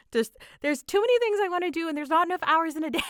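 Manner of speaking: half-laughing at how busy life is. Real, not acted. Overwhelmed, laughing at own schedule.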